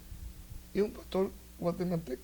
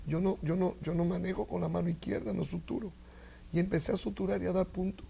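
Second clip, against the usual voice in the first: ordinary delivery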